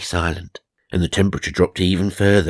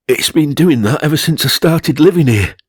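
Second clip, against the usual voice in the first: higher, louder put-on voice of a worn-out woman; Charmaine's voice; 7 semitones higher, 5 dB louder than usual. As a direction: play the resigned homeowner up high. Charmaine explains the frozen tea.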